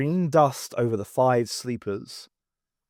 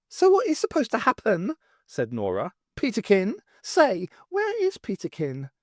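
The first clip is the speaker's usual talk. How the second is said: cook voice; 8 semitones higher than usual. Acted. Nora asking.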